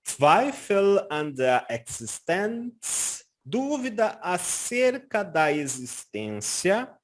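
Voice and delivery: very slow German, each word set apart; slow careful pronunciation. Shown as a demonstration. Teacher showing students how to pronounce it, very slow. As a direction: say it slowly until it comes easily. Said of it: "Pode pronunciar pausadamente".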